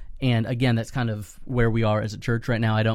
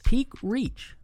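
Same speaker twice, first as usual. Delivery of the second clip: higher upbeat brand announcement; ad read; 12 semitones higher than usual. Acted. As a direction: sell it like a radio spot. Sponsor ad read.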